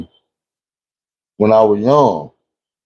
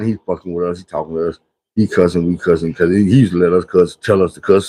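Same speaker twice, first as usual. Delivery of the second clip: usual speaking voice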